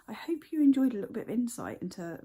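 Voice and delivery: ordinary delivery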